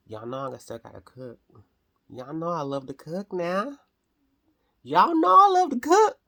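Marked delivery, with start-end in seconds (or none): 4.8-6.2 high, loud sing-song yell; playful shout. Pretend. Telling viewers she loves cooking, playful high shout. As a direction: belt it out to the whole room.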